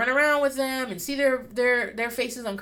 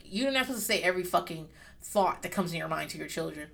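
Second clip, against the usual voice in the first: ordinary talk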